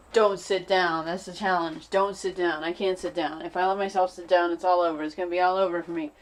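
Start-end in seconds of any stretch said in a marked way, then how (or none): none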